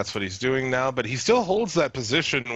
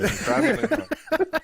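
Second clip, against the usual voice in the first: pitched up and laughing; laughing high-pitched repeat; 14 semitones higher, slower than usual. Pretend. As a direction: squeal it through a laugh.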